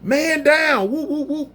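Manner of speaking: high mock holler of a crowd, fading into a laugh; people hollering. Bystanders hollering that a man is down, mock holler. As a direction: call out the alarm, half laughing. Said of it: "you got people hollering".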